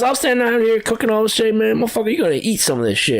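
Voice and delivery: hammed-up demanding buddy at the grill; Isaac's insistence. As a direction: ham up a pushy friend ordering you to eat. Retelling coworker's insistent demand.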